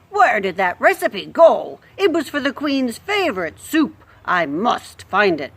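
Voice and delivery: a hint of a French accent on the sleepy cook. Sleeping cook searching for her soup recipe, light French accent. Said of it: "she's French all of a sudden".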